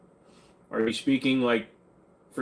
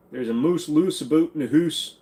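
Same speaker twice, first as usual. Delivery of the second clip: higher, put-on Scots-Canadian "oo" vowels; mock Scots-Canadian accent; 4 semitones higher, 3 dB louder than usual. Joke accent on a rhyming phrase.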